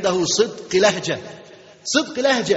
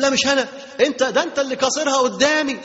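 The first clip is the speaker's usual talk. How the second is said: mock denial; 7 semitones higher than usual. Liar blames another, acted quarrel.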